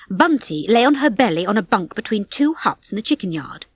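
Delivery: plain reading voice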